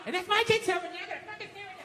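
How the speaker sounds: high imitated scolding fading very quiet; wife's fading tirade. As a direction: keep ranting high as the sound drops away.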